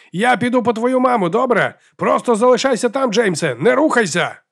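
urgent, high-pitched shout over the din; father shouting. A narrator's reading. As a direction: shout orders to your son over screaming crowds. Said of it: "Його батько тепер кричав, намагаючись перекрити нестерпний галас". (батько кричить, перекрикуючи галас)

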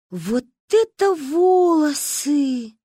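high, slow amazed exclamation; marveling. A narrator's reading. Girls marvel aloud at the long braid. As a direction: savour the amazement.

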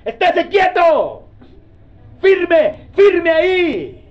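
high, forceful shout, drawn out; God's firm command. Acted. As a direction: order them loudly to stand still. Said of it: "Y Dios le dice". (orden de Dios, gritada y sostenida)

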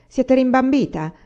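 high, loud scornful outburst; heated shout. A narrator's reading. Agata scorning the old servant's claim, heated shout. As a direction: fling the insult out hotly. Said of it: "gridò Agata accalorandosi".

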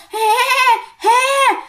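high, eerie breathy giggle; a spine-chilling little ghost laugh; creepy ghost giggle. Retold or imitated. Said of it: "nó cười cái giọng thấy ghê lắm".

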